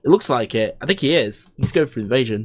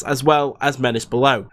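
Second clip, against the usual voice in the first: normal talking voice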